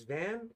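"Van" lifted high as a question. Acted. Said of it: "Question mark".

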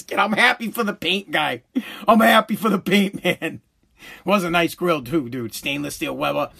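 ordinary talk, nothing marked

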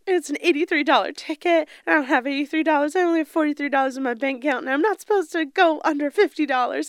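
imitating crying girl. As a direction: play up a tearful meltdown for the camera. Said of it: "and it's like".

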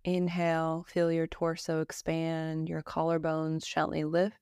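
slow, even meditation voice with long pauses; calm Yoga Nidra guidance, evenly pitched; guided meditation. Acted. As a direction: guide them gently through breath and body, unhurried.

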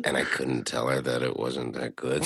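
very soft, low, hushed aside; confiding stage whisper. Pretend. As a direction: whisper it like a secret about your wife. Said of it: "I'm gonna whisper".

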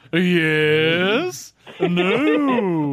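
a hint of a stuffy rich man; lightly voiced rich old man; Simpsons fancy guy. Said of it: "the guy from The Simpsons who goes".

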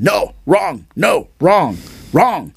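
mock a relentlessly fault-finding teacher; strict correcting instructor. Acting out relentless correction in class.

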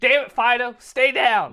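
high hillbilly holler at a dog; hillbilly accent. Acted. Yelling at Fido the dog, loud hillbilly accent. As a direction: yell it out like a backwoods dog owner, drawn from a hillbilly. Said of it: "talk like that".

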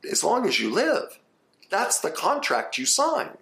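high-pitched, insistent lecturing; emphatic high insistence. Real, not acted. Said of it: "insistent rebuttal".

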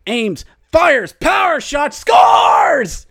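rising to a big shouted goal call; excited hockey goal call. Real, not acted. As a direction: build to a shout on scores.

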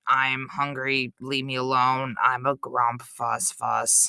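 low sulky grumbling voice; grumpy grump. Retold or imitated. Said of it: "He's like".

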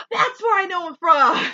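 a startled recall after a gasp; realization. Retold or imitated. Host recognizing the Allstate actor, sudden high realization.

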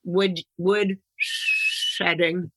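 slow, long hissed sh in "shedding"; drawn-out sibilant. Pretend. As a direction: stretch the opening of "shedding" into a hiss. Same sibilant joke slips in.

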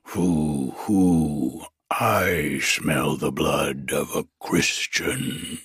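low, slow booming troll. Acted. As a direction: sniff out the intruder like a troll. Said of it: "he cried".

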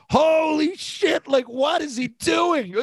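high, slow mock shout of disbelief; stunned excitement. Teammates watching the fight on TV, high shouted disbelief. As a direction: yell it like you can't believe your eyes. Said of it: "but going".

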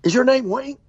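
high, excited calling voice, lightly mimicked; eager woman at a funeral. Lady in the pews calls out to the preacher, high and eager. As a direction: tug at his sleeve with a high, eager call. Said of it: "And she said".

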